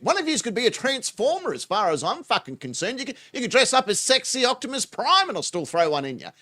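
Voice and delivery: usual speaking voice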